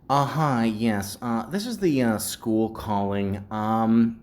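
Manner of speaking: a hint of a hesitant school caller, slow; halting, worried office voice; school receptionist. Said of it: "they said something like this".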